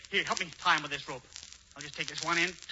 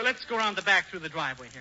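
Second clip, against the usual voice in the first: ordinary talk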